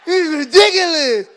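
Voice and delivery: loud, drawn-out martyred whining; dramatic teen complaint.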